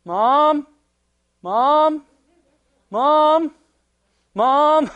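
high, loud repeated calling; child calling Mom. Retold or imitated. Daughter calling for Mom from bed, loud. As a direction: holler for Mom again and again. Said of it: "she's like".

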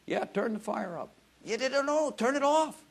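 mock Jesus reply. Preacher voicing Jesus answering from heaven, high joking voice. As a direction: play Jesus teasing back.

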